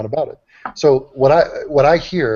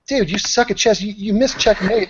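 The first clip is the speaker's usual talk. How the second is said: higher-pitched mock nitpicking commenter; mocking online critics; 7 semitones higher than usual. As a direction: mimic a chess know-it-all, pitched up. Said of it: "so people are like".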